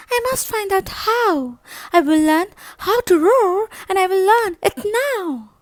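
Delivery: high, loud, resolved mouse voice; squeaky mouse making up his mind; determined little mouse. Acted. Mouse vowing to himself he will learn to roar, high and loud.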